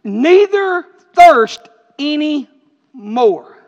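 very slow and loud, each phrase stretched; proclaimed scripture. Acted. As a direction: draw every word of the verse out.

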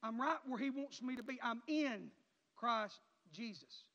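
normal talking voice

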